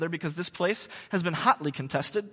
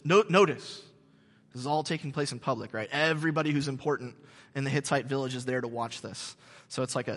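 usual speaking voice

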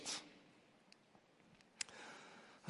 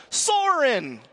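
loud, much higher shout of the name; yelled out; 10 semitones higher, 5 dB louder than usual. Retold or imitated. Classmate shouting the answer before Soren, loud yell. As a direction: blurt the answer across the classroom. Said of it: "yells out".

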